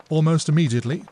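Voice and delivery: ordinary delivery, nothing marked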